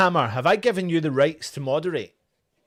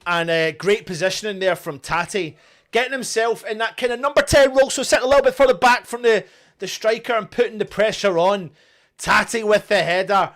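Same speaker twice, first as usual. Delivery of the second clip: usual speaking voice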